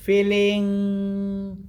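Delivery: FEELING stretched long on a sustained note; drawn-out. Shown as a demonstration. Meditation teacher showing how to feel, drawn-out. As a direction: hold out the last syllable of FEELING. Demonstrates feeling instead of thinking.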